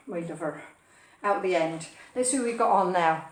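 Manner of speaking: ordinary delivery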